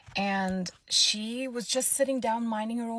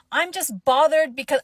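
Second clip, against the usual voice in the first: higher, louder defensive whine; imitating an indignant lady; 6 semitones higher, 10 dB louder than usual. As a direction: play her protesting, flustered. Rude lady tries excuse.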